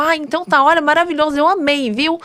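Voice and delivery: sweet, fussy fan wrapping up the chat; gushing lady. Retold or imitated. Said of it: "E aí ela falou".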